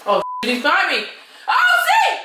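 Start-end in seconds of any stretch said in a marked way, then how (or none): 1.5-2.2 startled squeal. Real, not acted. Enemy behind her.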